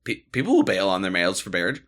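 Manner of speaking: "mails" said instead of "bails"; "Baird" blurred for "bailouts"; slurred mails. Real, not acted. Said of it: "Shut up".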